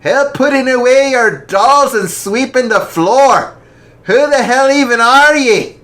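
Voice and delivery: high, loud mocking old man voice; gruff scoffing father. Scottish father mocking the rich girl. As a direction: sneer it out with scorn. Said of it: "Fife scoffed".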